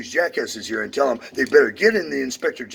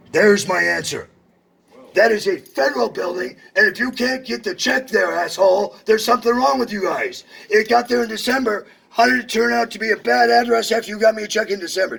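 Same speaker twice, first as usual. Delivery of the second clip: high, loud real anger; furious rant; 8 semitones higher, 3 dB louder than usual. Real, not acted. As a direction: rail at the clerk, voice up high.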